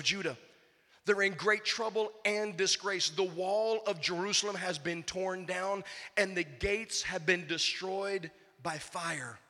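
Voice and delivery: slow, measured Bible reading; scripture reading. Acted. Pastor reading Nehemiah to congregation, solemn. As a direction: read the passage with weight. Reads scripture passage aloud.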